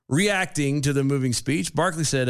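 ordinary delivery

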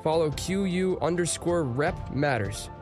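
ordinary talk, nothing marked

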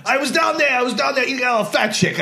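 mock hack comic. Imitating a hack comic's set.

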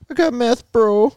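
very high, slightly loud squeak; high put-on voice. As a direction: push it way up high. Trying out disguised voices.

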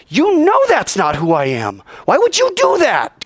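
indignant, pitch leaping high on the question; God. Acted. God to a hypocritical follower, high indignant rebuke. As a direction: demand an answer, hurt and incredulous. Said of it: "God says".

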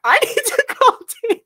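high, laughing wail; mock panic. Insisting she must call Taylor, laughing mock panic. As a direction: cry it out through laughter.

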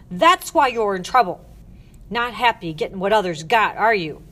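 loud, scolding matron; angry accusation. Acted. Matron accusing the girl of ingratitude, angry.

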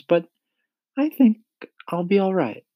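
put-on high-pitched boy's voice; polite James. A narrator's reading. James thanking the ladybug for her offer, high polite voice.

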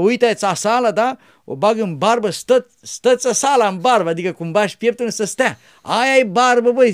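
put-on loud, high, animated old man; old monk explaining. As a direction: explain it loudly, full of life.

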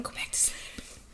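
soft whispered plea; whispering. Real, not acted. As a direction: coax the pet back to sleep. Hushing the pet off camera.

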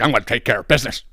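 fast, brisk self-assured mock; quick confident swagger; cocky manager. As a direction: lightly mimic her strut in the voice. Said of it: "she's walking like".

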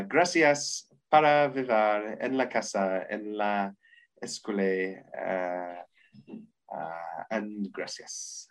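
slow, low, faltering Spanish with long hesitations; halting Spanish speech. Acted. Film speech in schoolgirl Spanish.

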